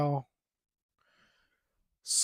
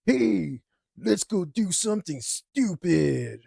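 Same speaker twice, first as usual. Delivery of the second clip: a hint of a wild buddy, slow and egging on; lightly voiced reckless friend drawling his dare; mock bar-owner pitch for trouble; wild friend's tone; 4 semitones higher, slower than usual. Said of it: "in this exact tone".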